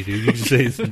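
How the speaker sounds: ordinary delivery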